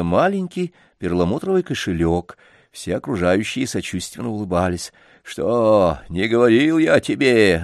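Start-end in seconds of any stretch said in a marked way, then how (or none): none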